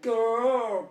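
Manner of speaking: huge, sudden roar ending in a cough; explosive roaring cough. Acted. Tigger showing the cough made behind Eeyore, explosive. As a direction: blast out a drawn-out growling cough.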